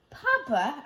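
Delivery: put-on high, eager little boy's call; child Isaac. A narrator's reading. Isaac calling to his father, high child voice. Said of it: "Isaac asked".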